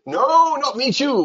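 high, loud "No, not me too" with "too" falling; corrective no. Shown as a demonstration. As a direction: cut in to correct them. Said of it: "We say likewise".

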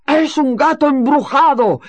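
high, loud shout. Acted. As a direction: yell it up the stairs. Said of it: "gritó el varón". (grito fuerte y agudo)

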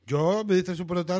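talking while chewing popcorn; munching popcorn. Real, not acted. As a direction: mouth full of popcorn. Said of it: "estoy comiendo palomitas".

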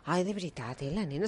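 put-on posh Catalan accent. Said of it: "el catalán pijo es de esta manera".

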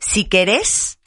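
"querés" stressed on its last syllable, the "és"; accent on final E. Retold or imitated. Host quoting mom's saying, "querés" stressed on the E. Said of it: "con acento en la E".

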